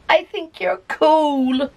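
high, slow sweet little ghost; lightly voiced shy little ghost. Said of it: "A little ghost tugs at his arm and says".

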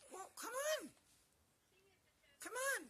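very high, pleading coaxing; puppy talk. Real, not acted. Owner coaxing puppy to jump, high puppy talk. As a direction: coax in a tiny high voice.

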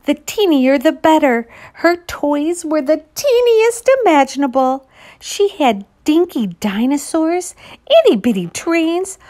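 ordinary delivery

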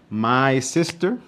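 slow, drawn-out sentence start; slow prompt. Shown as a demonstration. Teacher starting the answer for her students, slow. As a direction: lay out the first words slowly for the class.

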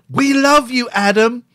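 put-on high loud child voice; kids cheering. Kids greeting the presenter, high and loud. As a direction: gush like an excited kid fan. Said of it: "kids would come up to him and go".

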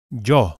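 "yo" with a light J glide at the start; "yo" with a faint J sound; yo with a hint of J. Shown as a demonstration. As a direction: say "yo" almost like jo, just a touch of J. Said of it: "similarly to English, but with just a hint of J".

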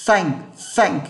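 teacher shows Thank with the tongue pushed out; English th sound. Shown as a demonstration. Teacher demonstrating Thank to students, tongue-out th. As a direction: Thank with the tongue between the teeth, a hissing th at the start. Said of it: "phải được cái âm rè như này nhé".

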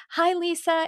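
high, bright hello; cheery greeting. Retold or imitated. She warmly greets her close friend Lisa, high and bright. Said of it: "I'd be like".

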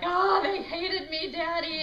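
high, loud hammed-up wail; mock sobbing. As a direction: imitate a girl sobbing to her daddy. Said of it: "that night sobbing".

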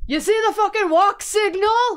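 high, loud angry holler; outraged pedestrian re-enacted at full pitch; retold yell. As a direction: shout it at a careless driver. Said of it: "So I started just yelling at him".

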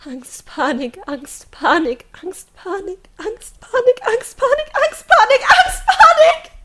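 from a hushed Angst up to a high, loud panicked cry; panic building. A narrator's reading. Girl panicking under the raging client, rising to a scream. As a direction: let the repeated words climb into panic.

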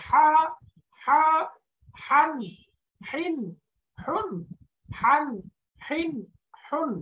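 high-pitched "হান, হিন, হুন" from mid-throat. Shown as a demonstration. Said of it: "গলার মাঝখান থেকে".

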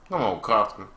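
ordinary delivery

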